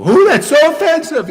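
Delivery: very high, loud mock outrage; hammed-up offended squeal; mock offended shriek. Mocking offended critic.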